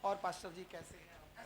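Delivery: very soft, low-key chat; hushed everyday conversation; talking to someone nearby. Shown as a demonstration. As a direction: speak softly, as to someone standing close. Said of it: "इनसे बात करूंगा ना".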